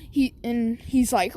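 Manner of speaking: usual speaking voice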